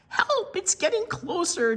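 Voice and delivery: high, panicked call for help; frightened cry. Acted. Jenny fleeing her little brother, crying out for help. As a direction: cry out as the monster gains. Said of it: "Jenny cried".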